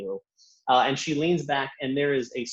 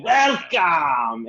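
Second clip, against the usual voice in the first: loud, higher shouted welcome in a put-on voice; Luba's greeting; 5 semitones higher, 7 dB louder than usual. Luba flying up to greet customers, loud put-on shout of welcome. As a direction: fling the welcome at the door like a hostess. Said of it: "she flies up to you".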